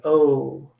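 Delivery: normal talking voice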